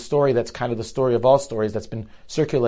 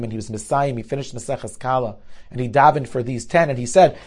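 ordinary talk, nothing marked

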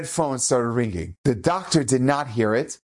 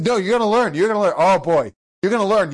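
mildly acted angry uncle, voice raised; insistent, higher-pitched scolding; stern threat; 5 semitones higher, 4 dB louder than usual. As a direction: insist over the kid's protests. Uncle drags nephew along, scolding.

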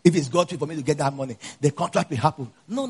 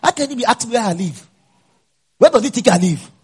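higher and louder indignant comeback; retold angry retort; 8 semitones higher, 4 dB louder than usual. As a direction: snap back at the boy in annoyance. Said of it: "Pastor, you are so angry".

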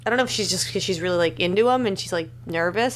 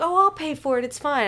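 high mock Alvy voice; 7 semitones higher than usual. Retold Alvy offering to pay.

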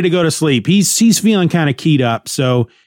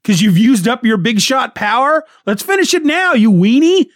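yelling; 8 semitones higher than usual. Retold or imitated. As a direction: yell it back as a dare. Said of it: "Frank yells back at him".

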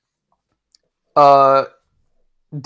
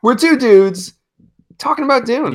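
mock announcer; 8 semitones higher than usual.

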